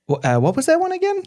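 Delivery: high, fast puzzled voice; puzzled, quick question; confused barista. Retold or imitated. Barista confused, asks again.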